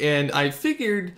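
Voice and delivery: usual speaking voice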